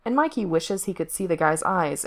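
usual speaking voice

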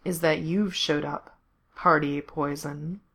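slow, purred taunt; purring. A narrator's reading. Villain greets his armed enemy, purring. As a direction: draw it out in a slow purr. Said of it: "he purred".

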